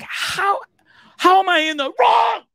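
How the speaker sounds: high, squealed mock outrage; indignant protest. Host protesting the franchise's telling-off, high mock indignation. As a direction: squeal in hammed-up disbelief.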